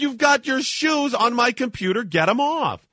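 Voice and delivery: angry yell. Retold or imitated. Traveller shouting at the man to get off his laptop, angry. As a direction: order him off the computer.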